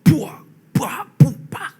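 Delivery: high, loud, alien shrieking cries; loud high possessed screeching. Retold or imitated. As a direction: imitate the wild howl from behind the door. Noise from the possessed woman inside.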